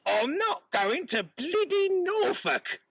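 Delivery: the butcher's wife fed up with her husband; fed-up market wife. Acted.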